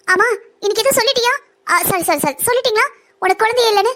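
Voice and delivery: sarcastic mock apology to her rival; mock sarcasm. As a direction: feign concern to twist the knife. Villain taunts sister-in-law mockingly.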